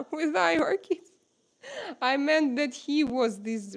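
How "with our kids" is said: laughing it out, high and slow. Real, not acted. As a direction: giggle through the correction. Laughing correction of misheard phrase.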